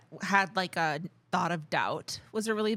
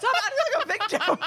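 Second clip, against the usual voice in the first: laughing tease; 13 semitones higher, 4 dB louder than usual. Real, not acted.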